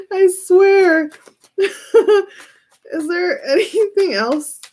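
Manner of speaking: high, slow words through tearful laughter; can barely get the question out; laughing. Real, not acted. Reader asking about the cards while laughing helplessly. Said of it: "I can't stop laughing now".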